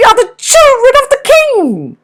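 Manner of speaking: tiny birds protesting; little birds crying. Acted. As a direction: wren children shouting at the bear. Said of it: "they cried".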